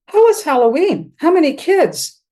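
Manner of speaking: cheerfully covering grief with ordinary chatter; forced small talk. Retold or imitated. To her sister while packing a dead father's room, a forced bright chattiness.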